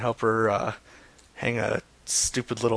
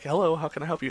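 higher, put-on worker's greeting; pretend phone greeting; 4 semitones higher, faster than usual. Prank caller coaches Neon on answering at work, pretend greeting. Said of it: "Just pretend to be like".

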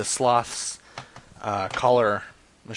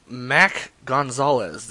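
slow sounding out; 5 dB louder, much slower than usual. Real, not acted. Sounding out a name from list.

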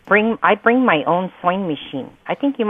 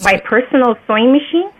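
higher, disbelieving question; incredulous; 6 semitones higher, 3 dB louder than usual. Real, not acted. Customer to store caller about her own machine, incredulous. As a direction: query it in disbelief, pitch climbing to the end.

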